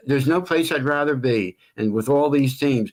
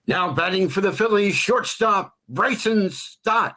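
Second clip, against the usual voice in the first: PA announcer call; 6 semitones higher than usual. Shown as a demonstration. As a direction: announce the batter like a ballpark PA.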